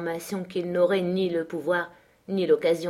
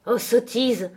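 higher, exasperated exclamation; exasperated cry; 5 semitones higher, 4 dB louder, slower than usual. A narrator's reading. Catherine cuts off Linton's self-abasement, exasperated. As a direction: burst out in exasperation. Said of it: "s'écria Catherine exaspérée".

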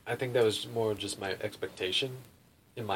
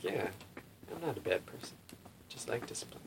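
half-convinced musing; 12 dB quieter than usual. Retold or imitated. Man mulls over the swami's verdict, half-convinced musing. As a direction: murmur it to yourself as a passing thought. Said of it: "kinda thought about that".

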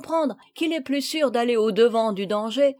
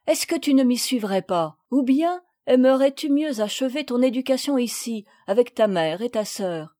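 plain reading voice

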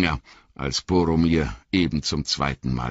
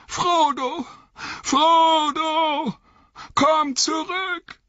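desperate high-pitched call into the distance; long, pleading high shouts; 3 dB louder, much slower than usual. A narrator's reading. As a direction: call him back, drawing out the words. Calls after the vanished Frodo.

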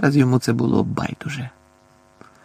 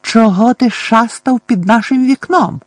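high, loud, indignant demand; seething aunt; 10 semitones higher, 5 dB louder than usual. A narrator's reading. Aunt confronts the boy about lurking by the window, seething. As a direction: scold him in a raised, angry voice. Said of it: "Вона кипіла гнівом".

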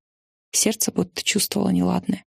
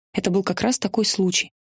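ordinary delivery, nothing marked

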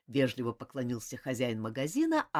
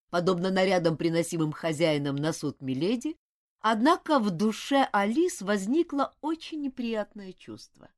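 ordinary delivery, nothing marked

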